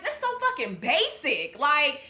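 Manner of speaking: ordinary talk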